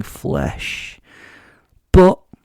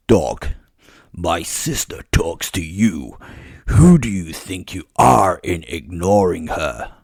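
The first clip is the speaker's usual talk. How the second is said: lower, slow, greasy and haughty; a lightly voiced arrogant vampire, looking down on him; greasy male vampire; 3 semitones lower, slower than usual. Male vampire scolding the werewolf for snubbing his sister, haughty. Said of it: "up sprang the male equivalent voice".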